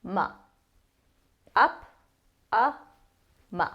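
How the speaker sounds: "ma" with the vowel of up; short a as in up. Shown as a demonstration. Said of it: "Same thing for the second syllable".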